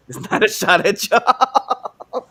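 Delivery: giggling while walking back the Southern accent joke; laughing. Real, not acted. Co-host laughing at his own slip, laughing.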